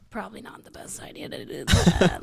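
whispering to herself; whispered self-talk. Retold or imitated. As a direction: mutter a regret under breath. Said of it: "I'll be like".

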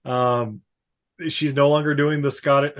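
ordinary talk

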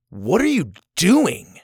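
drawn-out accusing question; angry. Shown as a demonstration. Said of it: "I'm angry with what you're doing".

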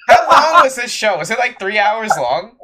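loud, incredulous rant about the show length; exasperated shout. Real, not acted. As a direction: belt it out in disbelief. Ranting about the long show.

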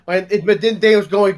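ordinary talk, nothing marked

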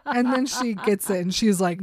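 usual speaking voice

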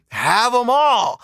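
high, slow, emphatic brush-off; dismissive retort. Retold or imitated. Retort to a man boasting about women, emphatic dismissal. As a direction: hand it all over with exaggerated generosity.

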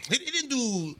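ordinary delivery

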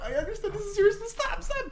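very high strained squeak; stifled laughter. Retold or imitated. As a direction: plead while fighting off a laugh. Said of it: "I tried so hard not to laugh".

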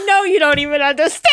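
a real laughing sob; laughing cry. Real, not acted. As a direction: near hysterical, shouting through laughter and tears. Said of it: "I'm obviously a hot mess".

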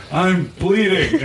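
put-on whiny kid. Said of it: "I was like".

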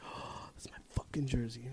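excited hushed whisper; whispered thrill at the package. Retold or imitated. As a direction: breathe it out under your breath. Realizing package is jersey.

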